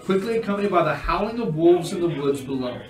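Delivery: atmospheric scene narration. Acted. Game master revealing a silent ruined house, atmospheric narration. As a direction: build atmosphere with pauses, then drop away.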